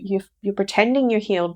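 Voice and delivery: ordinary talk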